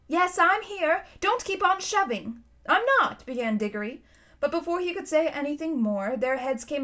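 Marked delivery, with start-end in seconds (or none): 0.1-2.4 put-on high-pitched, peevish child; cross girl's reply. A narrator's reading. Polly answering Digory in the dark, crossly. 2.7-3.2 put-on high, loud childish denial; indignant protest. A narrator's reading. Boy denying to Polly that he is shoving, indignantly. Digory protests at being accused.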